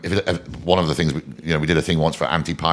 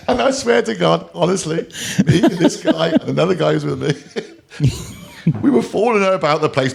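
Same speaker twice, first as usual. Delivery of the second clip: higher voice with laughter running through; laughing while telling; 11 semitones higher than usual. Real, not acted. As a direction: keep laughing as you tell it. Still laughing recalling the moment.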